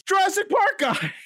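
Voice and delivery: high thrilled yelp of recognition; excited shout. Retold or imitated. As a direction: blurt it out in delight. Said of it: "And I was like".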